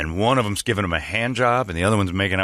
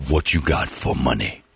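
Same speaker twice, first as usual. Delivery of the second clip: menacing pimp impression; 6 semitones lower than usual. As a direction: imitate a pimp warning someone off, pitched down. Voicing the pimp.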